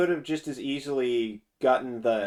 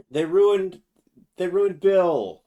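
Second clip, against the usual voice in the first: mock outrage; 6 semitones higher, 7 dB louder, slower than usual. Real, not acted. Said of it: "outraged at the recast".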